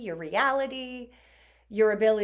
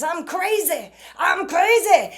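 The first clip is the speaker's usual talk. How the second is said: higher and louder mock chant of "I'm crazy"; mock ringer voice; 8 semitones higher, 9 dB louder than usual. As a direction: ham it up like a silly ringtone. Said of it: "the neighbor's ringer went off and it goes".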